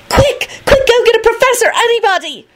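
high, frantic call for help; panicked high-pitched shouting; urgent shout. A narrator's reading. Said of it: "grabbed Esther's arm and shouted".

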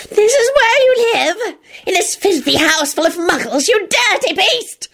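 slow, very high creature voice; shrill, outraged elf tirade; screeching elf. A narrator's reading. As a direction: screech in disgust, drawing words out. Screeching elf rails at Lupin.

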